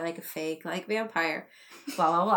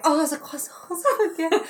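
put-on high-pitched frantic French accent; panicked mock French; 9 semitones higher, 3 dB louder, faster than usual. Actress fleeing imagined vampire at audition, frantic mock French accent. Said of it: "try speaking a bunch of French".

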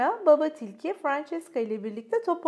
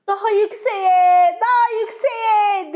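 high, loud, slow cheering; delighted child urging the kite higher; joyful; 8 semitones higher, 12 dB louder, slower than usual. A narrator's reading. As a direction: shout up at the kite with glee. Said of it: "neşeyle etrafta koşuşturarak".

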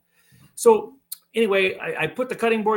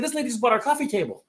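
high, fast, mildly panicked excuse; flustered explanation; 7 semitones higher, faster than usual. Retold or imitated. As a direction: act out hurriedly explaining yourself to your wife. Acted excuse to suspicious wife.

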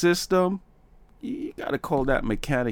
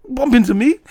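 loud, high, fast street challenge; mock confrontation; 14 semitones higher, 10 dB louder, faster than usual. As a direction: bark it like picking a fight. Acted confrontation in hallway.

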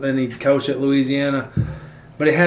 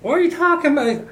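far higher, baffled mock voice of the players. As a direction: play a confused kid in a silly high voice. Players mock confused reply.

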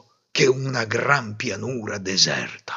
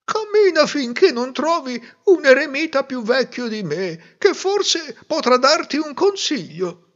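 kindly hermit; 14 semitones higher than usual. A narrator's reading. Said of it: "disse l'eremita".

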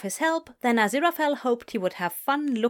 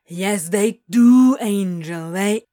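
loud, drawn-out contradiction; stubborn insistence; 9 dB louder, slower than usual. Acted. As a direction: insist loudly, stretching the words. Acted quarrel, demon insists.

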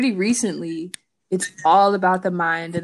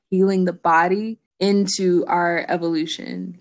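ordinary delivery

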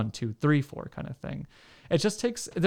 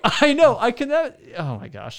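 coughing and laughing through choking; sputtering after swallowing wrong; choking on a drink; 13 semitones higher, 5 dB louder than usual. Real, not acted. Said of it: "I'm choking right into the mic".

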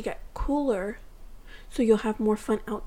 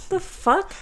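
incredulous; 10 semitones higher than usual. Retold or imitated. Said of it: "I'm like".

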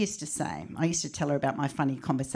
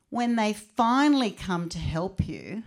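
ordinary delivery

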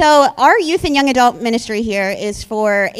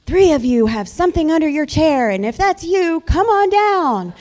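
game show host; 6 semitones higher, 5 dB louder than usual. Retold or imitated. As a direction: call them down like a game show. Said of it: "those TV shows where they're like".

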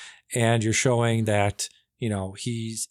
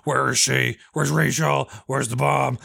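a light imitation of the growled Batman interrogation; mock-intense hero interrogating a villain; Dark Knight Batman; 3 semitones higher than usual. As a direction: bark the questions like the Dark Knight. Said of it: "Very reminiscent of".

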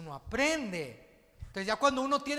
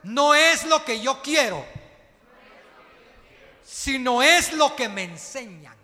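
loud congregational chant, led by the preacher; repeated loudly; 4 semitones higher, 13 dB louder than usual. Shown as a demonstration. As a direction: call it out strong for the church to repeat. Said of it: "quiero que repita conmigo fuerte". (el predicador dirige a la iglesia, fuerte y enfático)

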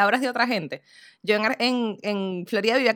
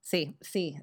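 ordinary delivery

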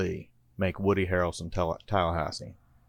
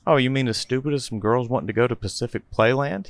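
staunchly; 5 semitones higher, 4 dB louder than usual. A narrator's reading. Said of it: "he replies staunchly".

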